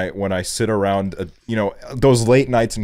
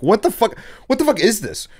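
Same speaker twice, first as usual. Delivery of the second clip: much higher, incredulous; exasperated rant; 10 semitones higher, 3 dB louder than usual. Retold or imitated. Ranting about a weed that gives headaches, high and incredulous. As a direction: throw up your hands in disbelief.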